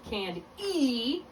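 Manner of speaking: canned E with the final E stressed and stretched; accented E. Shown as a demonstration. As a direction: say candy as can-DEE. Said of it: "If you have the accented E sound".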